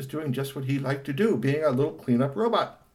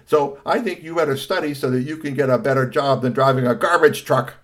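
loud, higher emphatic lecture; scolding father; 4 semitones higher, 9 dB louder than usual. Acted. Father lectures son about studying, scolding. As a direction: drive the warning home loudly.

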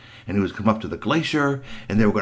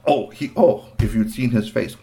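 plain reading voice

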